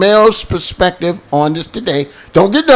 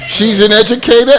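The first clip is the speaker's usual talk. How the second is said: normal talking voice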